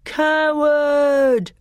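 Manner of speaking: long, high-pitched boo of a word; jeering shout. A narrator's reading. Telmarines heckling the fighter in the ring, high jeering shout. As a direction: jeer it out high and drawn. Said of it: "booed the Telmarines".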